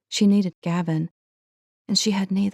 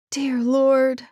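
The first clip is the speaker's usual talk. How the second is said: pleading; 7 semitones higher, 3 dB louder than usual. A narrator's reading. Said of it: "she pleaded out loud".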